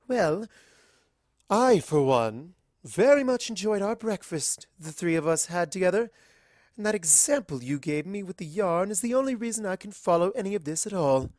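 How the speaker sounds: an elegant lady paying a compliment; lightly voiced posh unicorn; Rarity's voice.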